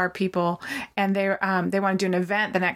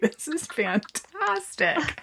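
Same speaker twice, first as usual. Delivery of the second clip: delighted; 7 semitones higher, much slower than usual. Retold or imitated. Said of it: "I'm like".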